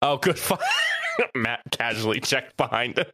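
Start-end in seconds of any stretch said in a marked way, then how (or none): 0.6-1.3 sudden high laughing shout. Real, not acted. Loud burst of laughter.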